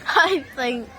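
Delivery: laughing through the words; giggling. Real, not acted. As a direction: giggle while starting to talk. Giggling while trying to speak.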